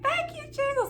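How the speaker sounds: very high, elated cry of thanks; thanking Jesus after reading the acceptance, very high; ecstatic thanks. Retold or imitated. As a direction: burst out in joyful praise. Reading Congratulations on letter.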